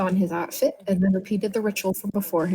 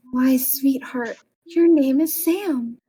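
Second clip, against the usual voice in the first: slow, high, sugary menace; sinister nurse; 5 semitones higher, 4 dB louder, much slower than usual. Acted. Nurse revealing his name to the patient, slow and sinister. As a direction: drawl it sweetly like a villain.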